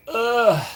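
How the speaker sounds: high-pitched "ugh" going out the door; mock startled groan, pitched high; hammed-up yelp. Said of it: "and I was like".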